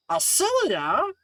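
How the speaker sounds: high, drawn-out astonished question; startled echo. Acted. Woman hearing her other name spoken, high startled question. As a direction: repeat the name in loud disbelief.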